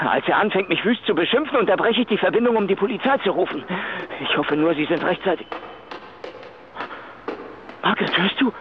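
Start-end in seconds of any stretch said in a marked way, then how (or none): none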